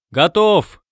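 high, falling answering shout; hollow, echoing-style answer, falling at the end; booming reply. A narrator's reading. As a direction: call back from inside, resonantly. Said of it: "гулко откликнулся Пишта".

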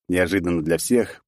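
ordinary delivery

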